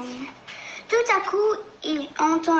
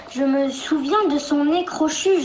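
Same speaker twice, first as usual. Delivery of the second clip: ordinary delivery, nothing marked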